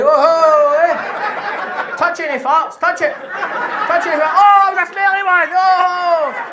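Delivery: high squealing mock mum, laughing through it. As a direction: shriek and giggle like mum poking a brain. Mimics mum poking brain, laughing.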